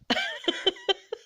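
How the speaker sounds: squeaky high burst of laughter; a high giggle at herself. Real, not acted.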